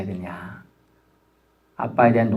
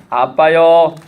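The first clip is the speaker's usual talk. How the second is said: put-on calling out to God like a child, louder; childlike prayer call; 3 semitones higher, 6 dB louder, much slower than usual. Shown as a demonstration. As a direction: cry out "아빠요" like a small child to his dad. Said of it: "그래서 이렇게 하는 거예요".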